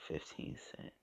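breathy whisper of the price; whispering to herself over the package. Retold or imitated. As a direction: hush it to yourself in wonder. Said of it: "I looked at the package again and I said".

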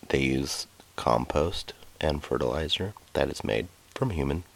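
hushed, near-whispered aside; softly murmured disclosure; whispering. Real, not acted. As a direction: drop the voice right down, as if confiding. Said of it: "Why are you whispering?".

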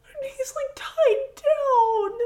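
very high, slow, drawn-out whine; plaintive lament. Real, not acted. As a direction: heartbroken squeak, stretched out. Lamenting the bear's fate.

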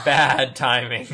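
slow, loud startled remark. Real, not acted. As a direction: blurt it out loud, drawn out. Reacting to gunshot sound.

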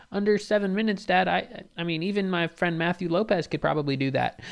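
put-on high, pleading boy's voice; whiny kid. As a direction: complain like a nervous small kid. Kid answers dad.

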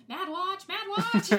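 high, loud, excited cry of the words; March Hare shout. Retold or imitated. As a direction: yell it out like the frantic March Hare. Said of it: "The way he says it".

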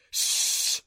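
sharp, voiceless "shh"; cutting hush to silence someone; hissed shush. A narrator's reading. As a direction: hiss an urgent shush. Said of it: "Adam hissed".

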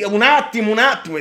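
loud, high flustered cry; panicked shout. Retold or imitated. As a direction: yell it in a traffic panic. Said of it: "e quindi tu sei lì".

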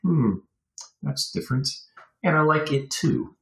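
high, musing voice of a fan splitting hairs; mock musing listener. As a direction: toy with it like a curious fan. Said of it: "you're like".

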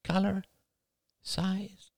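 put-on high, soft child's voice; small boy murmuring to himself, high; little boy. A narrator's reading. Boy sorts candy from his bucket, high child voice.

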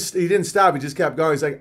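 ordinary talk, nothing marked

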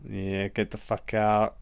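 normal talking voice

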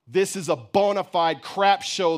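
loud, high angry outburst; narrated scream of outrage. A narrator's reading. Boy condemning his mother's baking, screaming. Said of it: "the boy screamed".

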